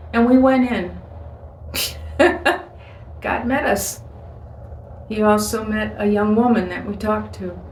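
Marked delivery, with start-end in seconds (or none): 1.0-2.6 short breathy laugh; brief, higher-pitched chuckle. Real, not acted. Preacher amused by own story, short chuckle.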